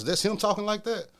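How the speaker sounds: high, loud disbelieving question; can't believe the skinny guy raps like that; retold disbelief. Asking Carol about the skinny rapper, in loud high disbelief.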